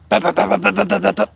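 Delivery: playful sound effect of a wrecked mower; imitated mower clatter. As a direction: mimic the mower deck banging away. Imitating broken mower blades.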